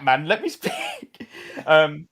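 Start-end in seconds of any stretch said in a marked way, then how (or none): 0.3-1.3 very high, slow, laughing through speak; silly stretched voice. Shown as a demonstration. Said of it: "No, not like that".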